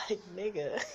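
high, breaking laugh; cracking up laughing at his own story; crying laughter. Real, not acted. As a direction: laugh till it cries.

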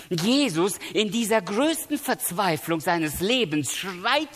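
high, impassioned preaching, voice lifted; preaching at full force. Acted. Preacher to the congregation about Jesus crying out, impassioned preaching. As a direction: raise the voice as Jesus cries out on the cross.

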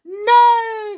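high, loud, drawn-out "no"; horrified cry. Acted. Mother and son see Grandma about to drink, horrified. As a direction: cry out to stop disaster. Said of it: "the other two cried".

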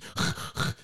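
unvoiced back-and-forth saw rasps; imitated sawing noise. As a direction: make the sound of a handsaw with breath. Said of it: "she's sawing away".

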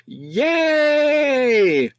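long, very high yay; drawn-out squeal of joy; high princess cheer, held long; delighted scream. A narrator's reading. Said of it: "Daisy screamed in delight".

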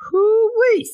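high whooping hoo-wee, way up; amused high-pitched hoot. Real, not acted. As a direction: let out a giddy high whoop. Reacting to the nose boop.

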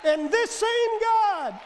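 loud, slow proclamation; preaching shout. Acted. Preacher to the congregation this morning, slow loud proclamation. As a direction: ring it out to the whole church.

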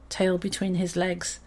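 ordinary delivery

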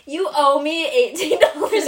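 high, loud, slow demanding mom; a hint of a nagging mother; stern mom. Mom calls demanding money.

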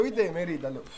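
usual speaking voice

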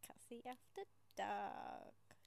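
slow breathy whisper of the title; late-night whisper intro; whispered show name. Acted.

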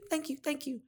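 high, soft mock thank-yous; lightly hammed-up repeated thanks. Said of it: "You do".

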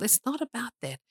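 usual speaking voice

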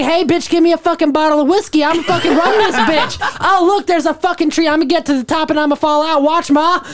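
put-on high, giggling drunk baby animal voice; drunk baby panda. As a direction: play a rowdy newborn panda, high and loose.

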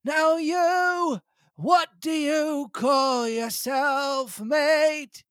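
put-on high, slow old castaway voice; Ben Gunn voice. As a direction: lift into a cracked old sailor. Ben Gunn voiced in read story.

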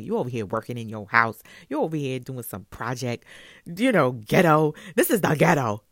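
mock yourself with contempt, rising to loud; harsh self-talk. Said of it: "I was literally talking to myself that way".

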